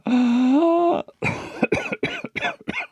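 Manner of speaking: high wheezing gasp into coughing; Tom's noisy breath. Shown as a demonstration. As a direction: suck air in high, then sputter. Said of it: "but goes".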